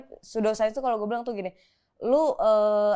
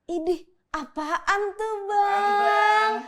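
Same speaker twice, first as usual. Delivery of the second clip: drawn-out mock whine; 9 semitones higher, much slower than usual. Teasing the pseudoscience term.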